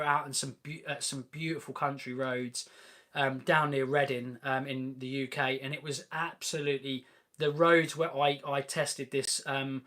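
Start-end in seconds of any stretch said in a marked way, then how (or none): none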